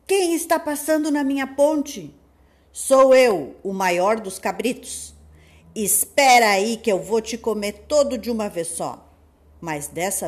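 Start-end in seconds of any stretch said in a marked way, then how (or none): 0.1-2.1 loud challenging wolf. Acted. Wolf challenges biggest goat. 2.7-5.1 big goat voice. Acted. Biggest goat answers boldly. 5.8-9.1 loud menacing wolf. Acted. Wolf threatens.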